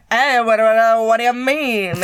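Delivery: loud mock New Orleans accent; garbled, excited New Orleans accent; Gambit. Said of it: "he was gonna play Gambit".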